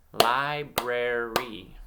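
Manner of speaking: "Library" slowly split as li, brar, y; syllable by syllable. Shown as a demonstration. Teacher giving children a word to blend themselves, syllable by syllable. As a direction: break "Library" into three separate beats. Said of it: "I'm gonna clap out".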